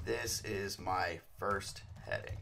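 slow and deliberate, word by word; slow dictation. Shown as a demonstration. Tutor dictating the heading text to viewers, slowly and evenly. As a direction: spell it out slowly as you type it. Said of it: "the content within the heading is just going to be".

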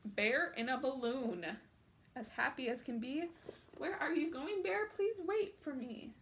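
put-on animated children's book narration; picture book read-aloud. A narrator's reading. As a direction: read it out like storytime for little ones.

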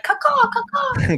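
high cawing crow call, drawn out; bird call. Retold or imitated. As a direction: caw like a crow signaling. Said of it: "Something like".

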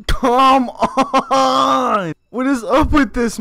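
high loud frustrated shout; frustrated yell. Real, not acted. Gamer yelling in frustration at the game, loud high shout. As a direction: bellow it in disbelief.

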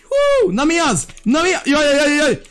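high-pitched flustered yelping; startled gamer's high yelps; alarmed. Real, not acted. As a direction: squeal in alarm, voice shooting way up. Startled during a sneak attack in game.

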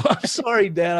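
high, loud, imitated teen apology; apologetic teen. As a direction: blurt sorry as a flustered daughter. Acted apology of teenage daughter.

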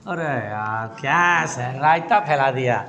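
very low, dismayed student grumble; mock-weary complaint in a low put-on voice; complaining student. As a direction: groan it as a let-down student.

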